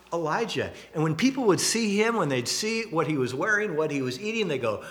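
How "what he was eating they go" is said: normal talking voice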